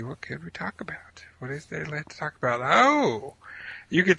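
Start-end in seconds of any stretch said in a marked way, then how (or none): 0.0-2.6 whispered to herself, the second line faster; whispered aside. Real, not acted. Whispered aside while hunting for something to talk about. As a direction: whisper it to yourself while stuck for a topic. Muttering to self, stuck for topic.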